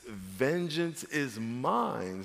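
very slow, soft solemn quote; solemn scripture. Shown as a demonstration. Preacher quoting the Lord, very slow and soft. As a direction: let each word land slowly. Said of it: "quoting scripture".

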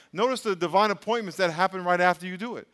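ordinary talk

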